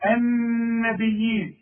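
النبيين slow, the doubled ن nasal and held; slow nasal recitation of النبيين; nasal ghunna. Shown as a demonstration. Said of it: "النون المشددة لازم تغن".

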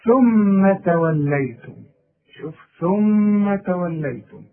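slow ثم with the mim held two beats; held nasal ghunna. Shown as a demonstration. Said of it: "يعني مثلًا إيه".

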